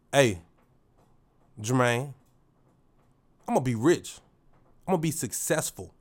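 firm, punchy mirror affirmation; assertive self-talk. Shown as a demonstration. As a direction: tell yourself like you mean it. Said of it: "this how I talk to myself".

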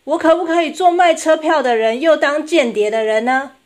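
put-on high, loud little-girl voice; small child blurting out a bright idea; loud child's question. A narrator's reading. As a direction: ask mum excitedly at full voice. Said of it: "他望着妈妈大声地说".

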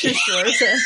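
laughing through the joke; chuckling as she jokes. Real, not acted.

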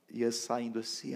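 ordinary talk

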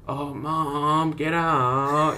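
put-on "Oh, Mom, get out" as a teasing spoiled kid; Eric Cartman-ish. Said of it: "a bit of an Eric Cartman kind of way".

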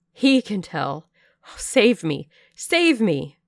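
loud cries for help, rising in pitch at the end; terrified cry. A narrator's reading. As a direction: plead loudly to be rescued. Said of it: "cried I".

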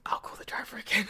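mock whisper. Clerk dodging the caller, imitated in a whisper. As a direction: breathe it like a sheepish secret.

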